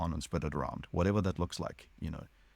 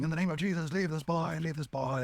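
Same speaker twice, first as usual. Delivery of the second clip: mock exorcist shout; 11 semitones higher than usual. Hostel master commanding demons to leave the boy, high mock exorcist shout. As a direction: boom it out like a televangelist casting out a demon.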